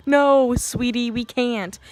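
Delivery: loud, drawn-out NO, then scolding; exasperated rebuttal. Acted. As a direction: slam down the silly advice, stretching the "No". Angry answer to mocked advice.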